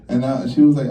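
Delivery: ordinary delivery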